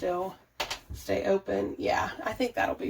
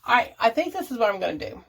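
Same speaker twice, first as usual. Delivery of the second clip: ordinary talk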